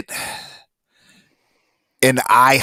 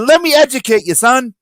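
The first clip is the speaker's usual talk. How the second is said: higher, cocky schooling tone; lecturing; 13 semitones higher, 4 dB louder than usual. Retold or imitated. Comic nerd schooling the theater kid, cocky mock lecture. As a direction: talk down like the expert. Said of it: "And I said".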